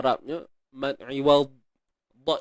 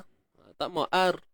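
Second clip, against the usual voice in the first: normal talking voice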